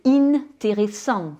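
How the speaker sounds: "intéressant" in French starting with a held "inn"; wrongly sounded N. Shown as a demonstration. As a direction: break the nasal in "intéressant" and sound the N. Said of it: "je vais pas le prononcer". (« intéressant » avec le N prononcé, « inn-téressant »)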